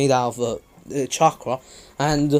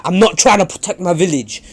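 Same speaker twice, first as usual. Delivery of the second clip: angry retort; 5 semitones higher, 7 dB louder than usual. Acted. Sasuke shouting back at Naruto.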